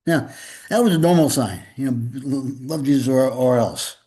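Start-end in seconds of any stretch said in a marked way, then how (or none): none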